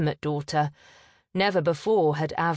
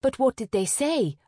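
higher, insistent question; mildly impatient, raised pitch; pressing; 7 semitones higher than usual. A narrator's reading. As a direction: press her for the answer. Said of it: "Avril pressed her little sister".

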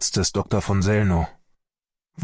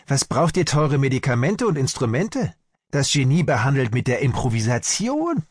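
higher-pitched taunting imitation; grinning mockery; 5 semitones higher than usual. Angry doctor imitates the Russian doctor's taunt, grinning mockery. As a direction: mimic her smug challenge. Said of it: "grinst die mich an".

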